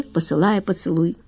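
ordinary delivery, nothing marked

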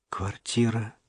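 soft, detached thought; inner voice. A narrator's reading. Stranger's voice inside Dasha's head, quiet inner voice. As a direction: let the word surface quietly, as if thought. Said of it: "произнёс кто-то посторонний в голове у Даши".

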